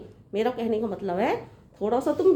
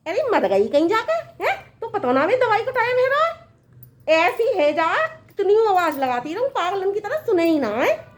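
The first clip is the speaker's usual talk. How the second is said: cross scolding; 6 semitones higher than usual. Acted. Mother-in-law berating daughter-in-law over medicine time, cross scolding.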